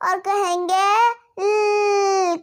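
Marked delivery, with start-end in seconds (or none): 1.4-2.4 ल held as a long "lll"; tongue-up L sound. Shown as a demonstration. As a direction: lift the tongue and sustain ल. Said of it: "अब जुबान ऊपर को करेंगे". (जीभ ऊपर, लंबा ल)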